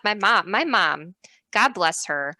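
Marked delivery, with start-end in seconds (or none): none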